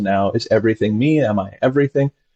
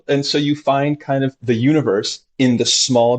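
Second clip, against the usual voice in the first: ordinary delivery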